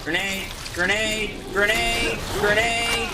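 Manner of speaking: rising shouted grenade warning; grenade warning yell. Real, not acted. Warning about grenade in game.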